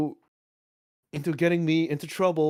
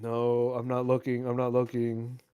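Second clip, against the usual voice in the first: sulky mutter; 7 semitones lower, 7 dB quieter than usual. Pretend. Mock denial, quiet.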